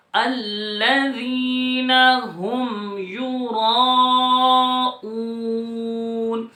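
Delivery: slow, sung tajweed recitation; Quran recitation. Shown as a demonstration. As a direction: chant the verse, stretching the long vowels. (ترتیل کے ساتھ تلاوت)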